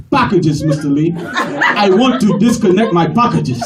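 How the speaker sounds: put-on firm, formal African accent; stubborn African caller's accent; dignified African accent. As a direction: correct the agent with an African accent. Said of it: "they so dignified and eloquent".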